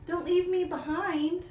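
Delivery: high, loud desperate plea; desperate pleading. Acted. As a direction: cry out not to be left. Coyote calling after crows.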